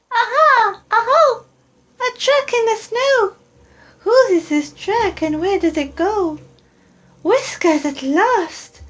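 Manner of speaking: put-on high eager child creature; Gruffalo's child. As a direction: squeak it out like a curious young monster. Child finds the track.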